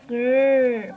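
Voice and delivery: loud growl of rage; a pig's loud furious growl; angry growl. Acted. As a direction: growl it out like an enraged pig. Bajie growls in fury.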